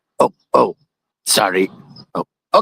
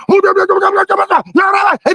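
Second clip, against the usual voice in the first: hammed-up shout. Narrator yelping during the retold shootout, mock alarmed. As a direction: yelp it out in a fake fright.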